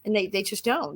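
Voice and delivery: ordinary talk, nothing marked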